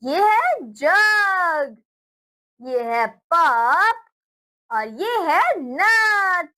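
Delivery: put-on slow, drawn-out words for children to echo; slow model for repeating. Shown as a demonstration. Teacher to children, modelling words to repeat, slow and drawn out. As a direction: stretch each word out so the class can copy. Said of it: "मेरे साथ दोहराते चलिए।".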